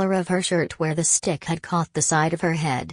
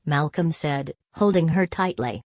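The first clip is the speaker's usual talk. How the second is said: ordinary delivery, nothing marked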